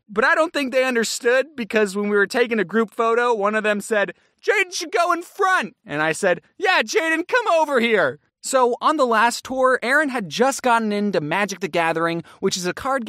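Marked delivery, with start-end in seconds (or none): 4.5-5.8 high-pitched imitated fan; eager fan. Fan arranging a group photo, eagerly imitated. 6.6-8.2 calling over. Retold or imitated. Calls friend over for photo.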